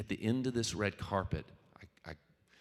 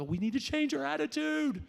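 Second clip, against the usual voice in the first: much higher, pushed mock shout with a triumphant ring; mock yell. Passenger finally answering the pilot, high mock yell. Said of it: "I won't yell 'cause it'll be too loud".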